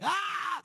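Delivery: hammed-up jump-scare shriek; screech scream. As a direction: shriek to scare someone in the doorway. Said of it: "I just let out the loudest, like, screech scream".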